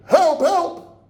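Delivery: scream for help. Acted. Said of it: "and I scream".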